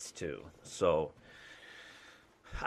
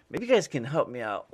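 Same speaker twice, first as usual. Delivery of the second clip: normal talking voice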